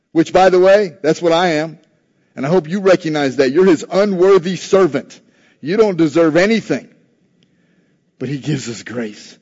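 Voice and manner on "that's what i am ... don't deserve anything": ordinary delivery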